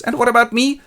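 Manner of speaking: high, loud complaint; hammed-up comic whine; whining suitor. Rodrigo left behind complains.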